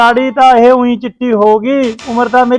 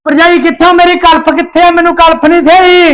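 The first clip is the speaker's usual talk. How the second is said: calling out; 6 semitones higher than usual. Acted. Shouts for his hair dye.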